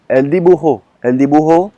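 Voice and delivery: "dibujo" with a Colombian Spanish d; Spanish D example. Shown as a demonstration. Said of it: "on a quoi comme, euh, comme mot en D en espagnol". (« dibujo » prononcé à l'espagnole)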